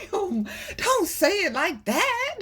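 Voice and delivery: high, slow, laugh-filled plea. Real, not acted. Laughing as she repeats her warning to viewers. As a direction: laugh through every word.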